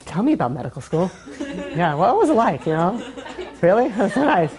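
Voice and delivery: giggling fake fascination with the doctor; laughing mock interest. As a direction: ask through laughter, pretending to care. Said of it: "go to a doctor and be like".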